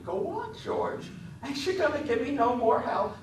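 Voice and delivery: put-on very high coaxing man-child; eager Lenny voice. A narrator's reading.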